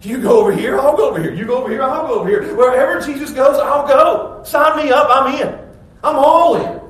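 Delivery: building from quick chatter to a high loud vow; bold proclamation. Retold or imitated. Mister Hasty following Jesus everywhere, bold eager proclaiming. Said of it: "boldly proclaims it".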